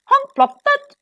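high, loud cartoon noises; playful storybook sound words; acted sound effects. A narrator's reading.

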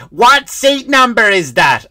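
high, loud, drawn-out question; hammed-up clueless loud talker; imitated loud latecomer. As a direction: bellow it like an oblivious old moviegoer.

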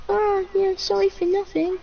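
mocking high-pitched quote; mimicking friend. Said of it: "and you're like".

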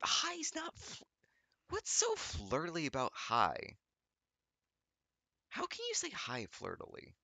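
high-pitched squeal of disbelief; incredulous. Real, not acted. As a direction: protest in a high, baffled voice. Incredulous outburst at the word.

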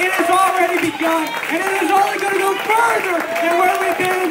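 high, sustained shouted sermon; full-force preaching. Real, not acted. Preacher rallies the church for what God is doing, shouting fervently. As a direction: preach it at the top of your voice.

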